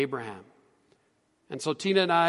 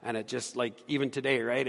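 ordinary talk

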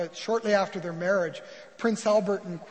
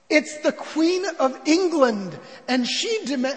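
raised, commanding royal proclamation; yelling queen; 6 semitones higher, 5 dB louder than usual. Acted. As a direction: announce yourself grandly through a locked door. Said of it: "she banged on the door yelling".

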